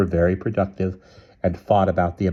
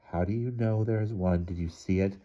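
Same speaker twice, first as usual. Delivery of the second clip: soft, calm questioning; 8 dB quieter than usual. A narrator's reading. Joan questioning her squealing mother, calmly and softly. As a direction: ask it calmly and much quieter. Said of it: "Joan said calmly".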